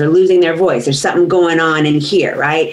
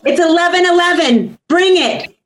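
bright cheer of bring it; excited hype; 12 semitones higher than usual. Real, not acted. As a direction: fire the group up.

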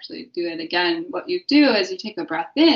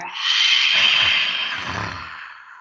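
lion's breath roar; 13 semitones lower than usual. Shown as a demonstration.

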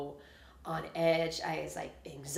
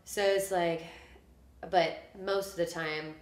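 ordinary delivery

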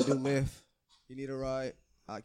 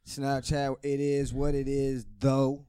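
loud drawn-out catchphrase; 8 dB louder, slower than usual. Acted. Signature sign-off catchphrase.

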